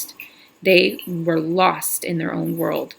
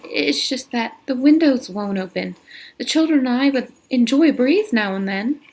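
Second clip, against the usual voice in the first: higher, lightly voiced young teacher; a hint of the flustered teacher, pitched up; Catherine voiced higher; 6 semitones higher than usual. Catherine continues, voiced high.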